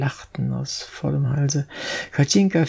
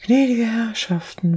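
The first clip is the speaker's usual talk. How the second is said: high, pleading beggar's whine; a touch of pitiful wailing; unsteady wailing; 8 semitones higher than usual. A narrator's reading. Little girl begs from passers-by, wailing unsteadily. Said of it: "unsicherer Stimme zu jammern".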